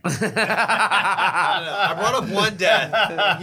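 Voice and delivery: high, drawn-out laughing retort; laughing protest. Real, not acted. Storyteller teased about bringing up deaths, laughing protest. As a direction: protest while cracking up, high.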